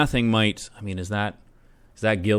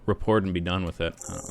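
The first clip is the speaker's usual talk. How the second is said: ordinary talk, nothing marked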